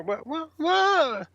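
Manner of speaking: high, drawn-out mock bewilderment; baffled astonishment. Band watches another crew jump the line, baffled. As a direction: imitate being utterly thrown.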